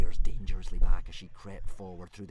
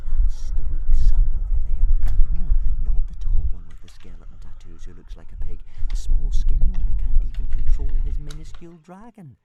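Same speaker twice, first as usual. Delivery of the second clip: crowd gossip; 5 semitones higher, 6 dB louder than usual. Acted. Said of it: "the crowd whispering to each other".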